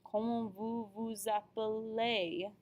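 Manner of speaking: "appelez" ending in a long "ay"; slow French model, "appelez" said "ah-puh-lay"; pronunciation demo. Shown as a demonstration. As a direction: draw out the final "ay" of "appelez". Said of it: "We also have the E Z".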